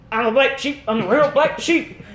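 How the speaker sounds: high rapper voice; quoted rapper. Retold or imitated. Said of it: "he was like".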